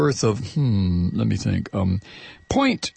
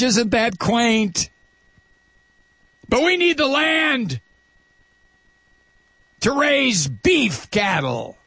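slow, higher mock-sweet sarcasm; sarcastic; 7 semitones higher, slower than usual. Host mocks corporate logic with slow sarcasm. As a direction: drawl it out, dripping with irony.

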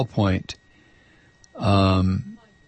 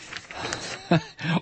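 breathy disbelieving laugh; 5 semitones higher, 7 dB quieter than usual. Real, not acted. Host laughs at irony.

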